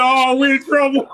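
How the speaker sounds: hammed-up teammate fretting; mock panic. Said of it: "He looked at me and said".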